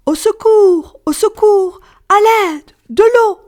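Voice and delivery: high, loud shout through cupped hands; crying out. Acted. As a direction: yell for help and water as loud as you can. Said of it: "Elle mit ses mains autour de sa bouche et crie".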